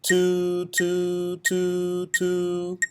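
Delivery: "Two, two, two, two" in one breath, each note tongued apart; one breath, tongue each "two" separately. Shown as a demonstration. Band teacher demonstrating articulation: each "two" tongued in one breath. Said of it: "Your turn".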